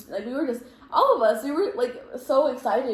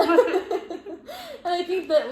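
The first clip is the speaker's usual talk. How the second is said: laughing; 9 semitones higher, 6 dB louder, much slower than usual. Real, not acted. Friend crying, laughing along.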